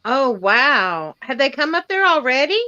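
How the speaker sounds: ordinary talk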